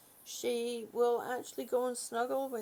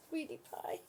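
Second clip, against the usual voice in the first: whispered endearment; 3 semitones higher, 8 dB quieter than usual. Real, not acted. Greeting her sleepy dog, whispered.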